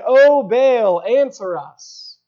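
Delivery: slow, loud, drawn-out cry to a god; slow calling out. Retold or imitated. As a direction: call out slowly and loudly. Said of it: "called upon the name of Baal".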